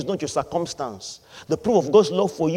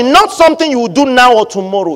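usual speaking voice

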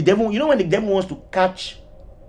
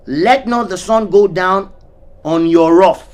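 slow, louder proclaimed verse; slow scripture quote; 5 dB louder, slower than usual. Acted. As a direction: lean on each word of the verse. Quoting scripture slowly.

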